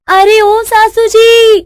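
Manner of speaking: high, drawn-out holler; long singsong yell on a high note; calling out. Acted. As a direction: summon someone from another room. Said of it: "बहू कब से चिल्ला रही है".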